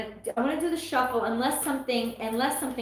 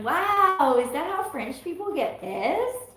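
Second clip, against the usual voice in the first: higher, amused wow; mock amazement; 6 semitones higher, slower than usual. Retelling her reaction to the film, mock amazed. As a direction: marvel at it playfully. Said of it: "I'm like".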